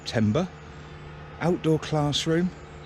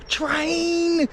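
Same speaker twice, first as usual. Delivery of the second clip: put-on higher, excited child's call of train; dad imitating his son's excited train call; 13 semitones higher than usual. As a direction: imitate a kid spotting a train. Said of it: "I can hear my son now".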